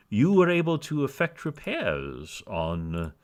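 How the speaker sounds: measured voice of a dour gnome boss; lightly voiced dour official. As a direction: say it with dour gravity, as if nothing were funny. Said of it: "this dour-looking man saying the words piss whistle".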